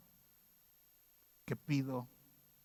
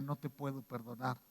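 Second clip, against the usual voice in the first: quiet grave verdict; 3 semitones lower, 8 dB quieter than usual. Retold or imitated. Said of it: "Diría".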